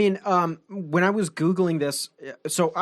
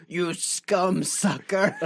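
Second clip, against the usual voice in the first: slow, put-on villain insult; berating gatekeeper; much slower than usual. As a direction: imitate the abusive gatekeeper, drawing out each word. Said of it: "he's just berating you".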